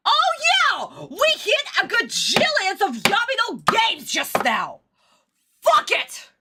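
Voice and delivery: frantic, high exclamation; high-pitched outburst. Real, not acted. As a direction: squeal it out in exasperation. Exclaiming over the bingo tally.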